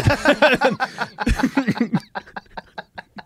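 high burst of laughter; real laugh. Real, not acted. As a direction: laugh at himself. Laughing about NDA irony.

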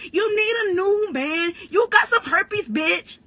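ordinary talk

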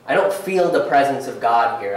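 ordinary talk

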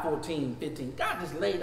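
normal talking voice

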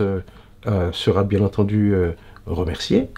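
ordinary delivery

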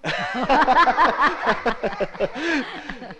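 amused falling laugh; laughter. Acted. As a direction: laugh at the child's answer. Teacher amused by child.

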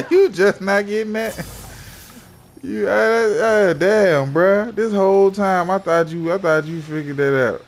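laughing at teammate's cluelessness; laughing tease. Real, not acted. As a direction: crack up while teasing him.

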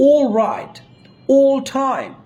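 long held "aw" in all right, all time; drawn-out vowel. Shown as a demonstration. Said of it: "একটু টান দিয়ে উচ্চারণ করতে হবে".

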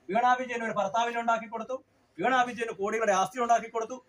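loud, high, heated rally speech; fiery rally shout. Real, not acted. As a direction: hammer it out to the crowd. Fiery protest speech attacking government.

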